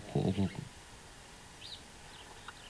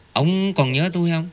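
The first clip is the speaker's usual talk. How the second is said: higher-pitched mock confrontation; retold glaring anger; 7 semitones higher, 4 dB louder than usual. Dying son confronts his weeping father, retold anger. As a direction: put it to him pointedly, pitched up. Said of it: "giận, giận dữ mà".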